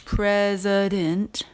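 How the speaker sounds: president slow, the middle vowel reduced to schwa; president, let the middle vowel go weak. Shown as a demonstration. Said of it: "when you get the schwa, uh, sound".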